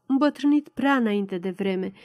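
usual speaking voice

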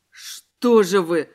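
drawn-out low, loud cry of dismay; exclaiming. A narrator's reading. Воронов reproaches his guest for the noise, exclaiming. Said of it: "воскликнул Воронов, схватившись за голову".

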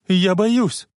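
high-pitched, scared call; frightened cry. A narrator's reading. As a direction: cry out in fear. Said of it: "испуганно крикнула Клара". (испуганный крик)

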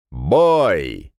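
loud command to start; calling out. Acted. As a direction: shout the start of the bout. Referee starts the fight.

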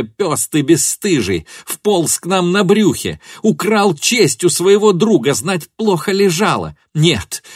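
high, loud outraged shouting; enraged tirade. A narrator's reading. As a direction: lash out at him, beside herself. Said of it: "крикнула Фенемора вне себя".

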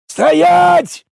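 very high, loud, long-held shout; yelling. A narrator's reading. Crazed warrior chasing the player, yelling. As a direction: holler the command after a runaway. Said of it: "заорал сумасшедший непись".

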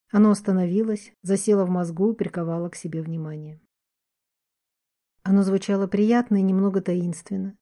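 usual speaking voice

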